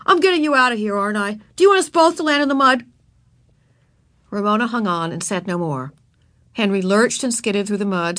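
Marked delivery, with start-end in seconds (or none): 0.1-2.9 louder, higher scolding; bossy, exasperated boy. A narrator's reading. Boy carrying a struggling girl, exasperated. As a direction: rattle off an impatient telling-off. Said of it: "he ordered".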